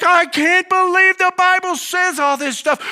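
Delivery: high, loud incredulous outburst; reliving his amazement at the Bible's words; amazed disbelief. Retold or imitated. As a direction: blurt it out in shocked wonder. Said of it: "I was like".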